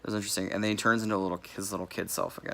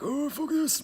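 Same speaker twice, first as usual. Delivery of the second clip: much higher, panicky little squeal; squeaky mock fright; slower than usual. Punks fleeing Jason, in a high mock squeal. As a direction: bail out in a hammed-up squeak. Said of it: "they're like".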